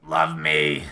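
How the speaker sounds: low, slow, loud gravel voice; gargling nails voice. Retold or imitated. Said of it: "Mickey sounds like he's gargling nails".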